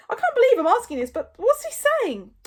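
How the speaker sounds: high, loud, incredulous question; squeaky disbelief; Fishlegs. A narrator's reading. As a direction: blurt it out fast and high. Fishlegs reacting to the dragon.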